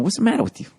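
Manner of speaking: high-pitched movie gangster scolding; mock mobster berating; Jimmy. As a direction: scold with pitch jumping way up, dropping the r. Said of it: "His fucking Jimmy's going".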